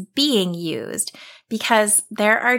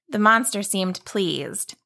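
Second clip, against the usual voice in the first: plain reading voice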